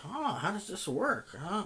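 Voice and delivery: feigned cluelessness. Retold or imitated. Old man asking kids about the soda machine, feigning ignorance. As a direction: play dumb in a put-on high voice. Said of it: "acting like you don't know".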